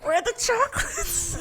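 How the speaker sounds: put-on very high old granny voice, laughing; grandma voice. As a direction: squeak it like a sweet old grandma. Mocking grandma voice.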